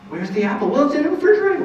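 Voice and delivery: put-on high, sing-song questions to a small child; playful parent. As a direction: pretend searching for the apple.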